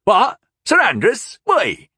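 high, startled cry of alarm. A narrator's reading. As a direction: blurt it out in fright. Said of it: "the man cried in alarm".

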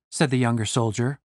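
ordinary delivery

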